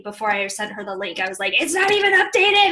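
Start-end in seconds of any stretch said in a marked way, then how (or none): 1.6-2.7 high, loud flustered cry; mock alarm. Realizing her website is outdated, a flustered high cry. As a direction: blurt it in playful alarm.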